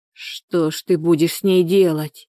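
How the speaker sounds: ordinary delivery, nothing marked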